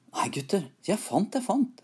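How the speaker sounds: eager call climbing high; rising, delighted storybook call; excited calling out. A narrator's reading. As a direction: build to a high cry of discovery. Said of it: "ropte han".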